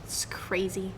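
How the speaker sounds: hushed whispered aside. Real, not acted. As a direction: whisper it under your breath.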